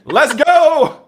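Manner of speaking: very high, very loud hyped-up yell; excited shout. Retold or imitated. Uncle retelling his reply to nephew's return, hammed-up excited shout. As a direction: shout it like a mock rallying cry. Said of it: "I was like".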